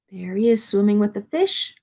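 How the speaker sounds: plain reading voice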